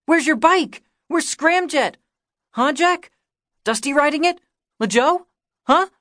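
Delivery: put-on high, loud, rapid-fire little-boy questions; boy badgering his idol with questions; babbling kid. A narrator's reading. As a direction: pester him like a clingy younger kid. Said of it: "Kiki's babble".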